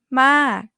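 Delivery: "Ma" starts high and loud, then falls; falling tone ma. Shown as a demonstration. Teacher demonstrates the falling tone on Ma. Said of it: "falling tone".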